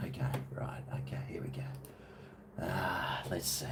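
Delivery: whispered, to himself. Real, not acted. Logging into his channel, whispering to himself. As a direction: murmur it while concentrating. Typing password, muttering.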